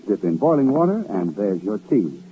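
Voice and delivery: normal talking voice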